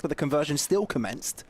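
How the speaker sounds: ordinary delivery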